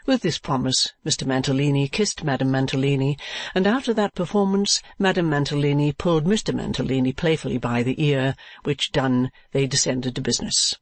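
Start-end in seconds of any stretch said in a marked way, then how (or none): none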